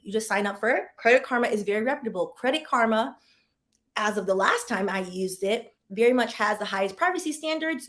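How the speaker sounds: usual speaking voice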